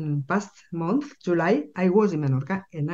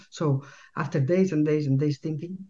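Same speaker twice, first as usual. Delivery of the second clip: normal talking voice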